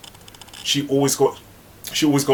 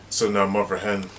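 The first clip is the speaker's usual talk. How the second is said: usual speaking voice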